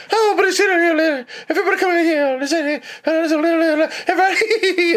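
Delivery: high, laughing mock concert banter; imitating singer on live album. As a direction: ham up a giggly singer calling out. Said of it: "and then everybody in the crowd just laughs".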